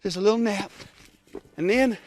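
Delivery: woozy. Acted. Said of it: "Oh, I'm woozy".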